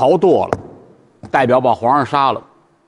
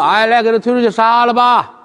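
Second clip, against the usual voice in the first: put-on drunken order; 6 semitones higher than usual. Drunk emperor orders execution.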